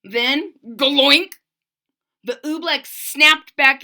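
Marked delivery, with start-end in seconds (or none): 0.7-1.4 gloink sound. Acted. Sound effect word.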